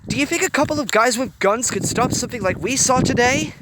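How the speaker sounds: flaring anger. A narrator's reading. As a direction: press her hard. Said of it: "his anger flaring again".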